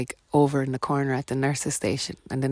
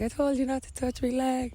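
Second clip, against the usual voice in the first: high, whiny mock complaint; mock annoyed; 11 semitones higher than usual. Going mad at lads.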